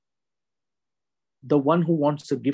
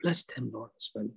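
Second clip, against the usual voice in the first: ordinary talk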